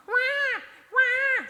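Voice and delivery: put-on high wailing baby cry "guau"; imitated baby wail. Preacher mimics a newborn's cry to the congregation, high wail. Baby crying demanding at night.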